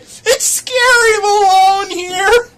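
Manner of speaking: hammed-up fake sobbing; mock crying. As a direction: wail like an abandoned child. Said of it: "Oh, don't cry, baby".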